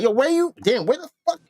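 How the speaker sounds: high-pitched squeal of a surprised guy asking where you're from; high mocking voice. Friend mocking a carnival guy shocked by his dark skin, high-pitched voice. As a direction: pitch way up, playing the startled partygoer.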